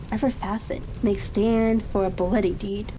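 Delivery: ordinary delivery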